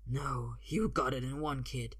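low character voice of a threatening man; menacing fighter. A narrator's reading. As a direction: speak low to intimidate a kid. Escaped fighter voiced in a story.

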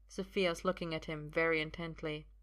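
usual speaking voice

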